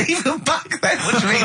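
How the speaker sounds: real laugh of disbelief; laughing incredulous query. Real, not acted. Laughs at Carl's remark.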